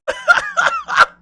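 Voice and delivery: a real high, shrieking burst of laughter; real squealing laugh. Real, not acted. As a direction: cackle way up high at the joke. Reacting to chat insult.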